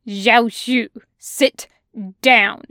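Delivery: loud, firm order; forceful command. Acted. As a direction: command him to sit. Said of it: "says forcefully".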